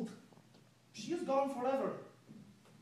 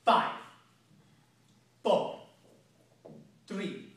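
slow deliberate countdown; menacing countdown; 3 semitones lower, 6 dB louder, slower than usual. Acted. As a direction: count it down coldly, word by word. Villain counts down to execution.

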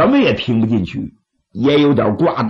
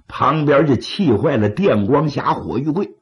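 ordinary delivery, nothing marked

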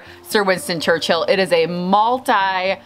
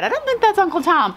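lightly voiced, higher-pitched commenter; quoted commenter; 11 semitones higher than usual. Florist quoting viewers' comments, higher mock commenter. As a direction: quote a doubter in a raised pitch. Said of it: "in the comments said".

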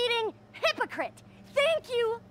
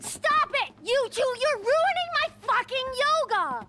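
exasperated yell; 6 semitones higher than usual. Acted. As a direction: shout them down, at the end of her rope. Mother yells at fighting pair.

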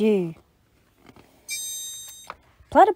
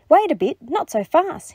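high-pitched storybook objection; pitched-up, bristly protest; bristling; 8 semitones higher, 4 dB louder than usual. A narrator's reading. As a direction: cut in, mildly indignant. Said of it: "he bristled".

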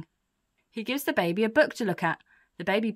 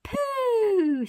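put-on very high, drawn-out baby echo; squeaky baby parroting; 3 dB louder than usual. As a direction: copy it like a toddler, long and high. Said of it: "says the baby".

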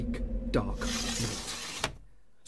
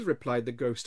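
usual speaking voice

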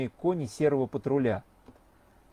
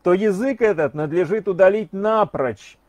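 louder, pointed lecturing; loud declaiming; 5 semitones higher, 7 dB louder than usual. Acted. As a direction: proclaim it loudly so the street hears. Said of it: "громко говорил он".

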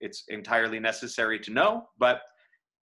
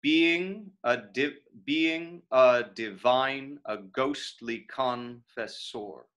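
"Being a divine" beaten out syllable by syllable; slow scansion; much slower than usual. Shown as a demonstration. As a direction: test the metre slowly, fading on "confessor". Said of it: "this is a feminine ending".

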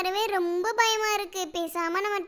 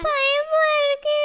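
put-on high, slow frightened child; scared whimper; 6 semitones higher, 4 dB louder, much slower than usual. Crying child tells her friend she is scared, high slow whimper. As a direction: whimper it through tears.

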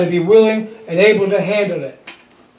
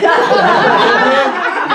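hearty laugh; 3 semitones higher than usual. Real, not acted. Laughing at his own joke.

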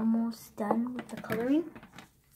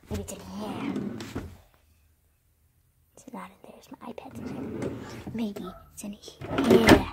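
whispering. Real, not acted. Whispering while hunting in box.

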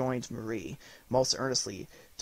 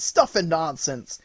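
slow, settling down into a gruff scoff; scoffing repeat; 4 semitones higher, 4 dB louder, slower than usual. A narrator's reading. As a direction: repeat it calmer, still dismissive. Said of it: "he cried out".

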